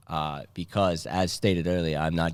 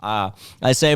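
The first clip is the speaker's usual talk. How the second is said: ordinary talk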